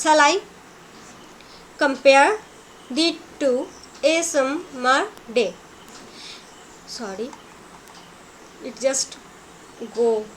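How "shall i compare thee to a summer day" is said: "Shall I", "compare", then the rest, with pauses between; slow scansion, pausing after "Shall I" and "compare"; divided into feet. Shown as a demonstration. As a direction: chop "Shall I compare thee to a summer day" into short metrical units. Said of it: "now divide it into two parts".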